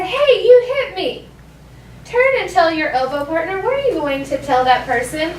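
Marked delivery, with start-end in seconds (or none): 0.0-1.3 higher, slow, indignant playground protest; mock mad. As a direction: act out an offended kid, drawn out. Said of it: "they get really mad".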